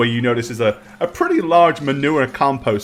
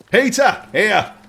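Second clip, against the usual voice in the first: higher-pitched call to a mate; 9 semitones higher, slower than usual. Acted. Stablehand summoning Peter with a coin, calling out. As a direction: pitch it up to get someone's attention. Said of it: "he takes it and he goes".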